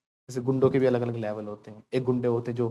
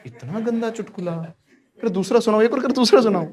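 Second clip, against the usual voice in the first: loud sarcastic shout, pitched up; mock angry shout; 7 semitones higher, 4 dB louder than usual. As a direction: bark it at the student in mock rage. Angry teacher shouts at joke student. (गुस्से में चिल्लाकर)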